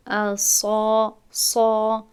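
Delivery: صا said thick and emphatic, the correct way; heavy emphatic صا as in tajwid; heavy sad. Shown as a demonstration. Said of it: "اگر من این را پر بخوانم، یعنی درست بخوانم".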